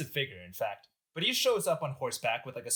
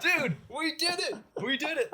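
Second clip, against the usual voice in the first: jubilant; 13 semitones higher than usual. Acted. Rioters who think they killed Napoleon, jubilant. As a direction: celebrate in a high, stretched voice.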